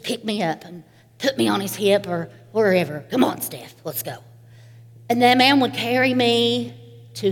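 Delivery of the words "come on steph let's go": gruff grandpa. Retold or imitated. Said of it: "in that gruffy voice".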